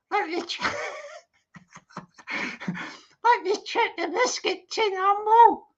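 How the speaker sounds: the doll peers into the tin; dummy voice. Acted. Dummy Amanda checks biscuit tin.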